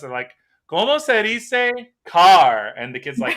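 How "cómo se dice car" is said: loud, slow, higher-pitched quizzing teacher; hammed-up classroom question; Spanish class teacher. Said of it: "in Spanish class, they're like".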